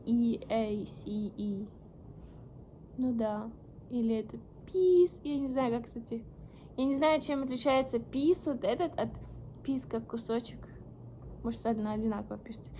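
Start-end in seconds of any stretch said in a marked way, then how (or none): none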